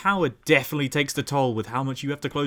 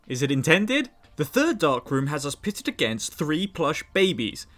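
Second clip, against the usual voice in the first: ordinary talk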